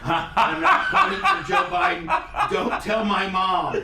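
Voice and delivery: mocking imitation. Friend mocks streamer's line.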